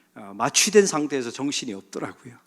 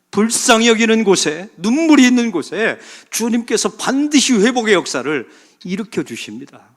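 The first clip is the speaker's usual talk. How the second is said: loud, high preaching climax; full-force preaching; 8 semitones higher, 9 dB louder than usual. Acted. Pastor drives home his point to the congregation, preaching loudly. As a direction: preach it out to the pews.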